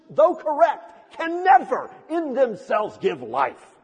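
loud, high, impassioned challenge to the congregation; full-force preaching. Real, not acted. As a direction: preach it at full voice, pressing each question home.

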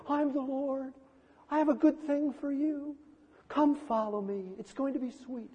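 a sweet-talking, easy invitation, lightly voiced; gentle Lord.

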